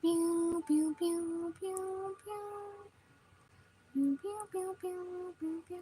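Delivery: soft high pew noises; playful pew pew under the breath; pew pew sound effects. Real, not acted.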